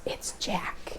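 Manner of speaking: conspiratorial whisper; hushed teasing aside; whispered joke. Acted. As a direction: whisper the blame on the dog. Whispered joke blaming the dog.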